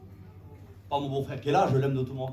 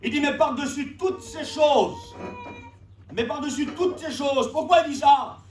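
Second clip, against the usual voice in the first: fervent scripture preaching; 10 semitones higher, 8 dB louder than usual. Real, not acted. Expounds scripture at full force. (prédication solennelle et enflammée)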